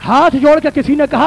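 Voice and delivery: ordinary delivery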